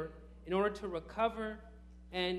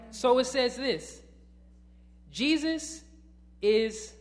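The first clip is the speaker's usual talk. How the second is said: raised, louder pulpit delivery; emphatic preaching; 5 semitones higher, 6 dB louder than usual. Real, not acted. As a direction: drive the point home to the congregation.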